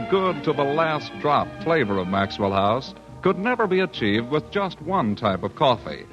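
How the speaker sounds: usual speaking voice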